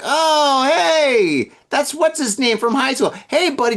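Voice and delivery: put-on high, gushing drunken hello; excited greeting. Acted drunk greeting of old acquaintance.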